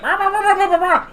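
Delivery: high rapid creature yips; yipping. Acted. Kobold yips back at the party, high. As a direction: yip like an angry little kobold. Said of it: "he's just yipping".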